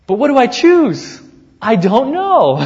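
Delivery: high, loud bewildered musing; puzzled Paul. Retold or imitated. Preacher voicing Paul's dilemma, high and bewildered. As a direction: throw up your hands, unsure which to pick. Paul voiced wondering aloud.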